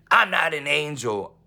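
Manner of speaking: insisting loudly she is no angel; emphatic protest. Real, not acted. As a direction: push back hard, slowly. Protests being called angel.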